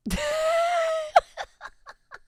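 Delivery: high squealing laugh. Real, not acted. Laughs at bear cub.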